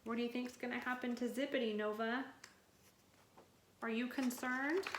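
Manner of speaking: hushed side question; soft aside. Real, not acted. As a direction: drop out of the story to ask her. Aside to child listener.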